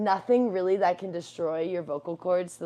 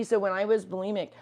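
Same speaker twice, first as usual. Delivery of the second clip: usual speaking voice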